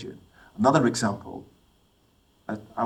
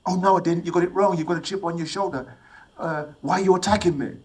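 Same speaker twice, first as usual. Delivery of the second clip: mock indignant denial; 6 semitones higher than usual. Imagined woman on the train denying her remark, mock indignant. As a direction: play her rushing to deny it. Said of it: "I know what would happen".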